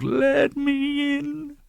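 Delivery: very high, very slow wail; wailing ghost. A narrator's reading. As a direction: draw the plea out into a long ghostly wail. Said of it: "Still it wailed".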